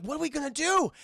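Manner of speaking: high-pitched helpless wail; mock panicked question. Companions lost without their guide, mock panicked question. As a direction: cry it out in hammed-up despair.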